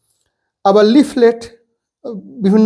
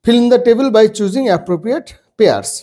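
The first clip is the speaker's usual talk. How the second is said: reading the instruction aloud; 3 semitones higher, much slower than usual. Shown as a demonstration. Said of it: "সেই help box থেকে".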